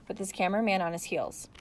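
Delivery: ordinary delivery, nothing marked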